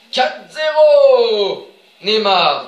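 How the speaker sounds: loud, high, drawn-out goal call; goal shout. Real, not acted. Gamer celebrating Neymar's goal, long loud shout. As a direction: belt out the score and scorer, stretched long.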